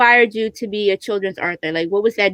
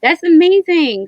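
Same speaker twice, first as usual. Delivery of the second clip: amazed; 8 semitones higher, 6 dB louder, slower than usual. Real, not acted. Host reacts to inspiring story.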